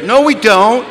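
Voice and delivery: high, loud rising denial; mock-indignant rebuttal; loud contradiction. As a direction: blurt out the denial, pitched high.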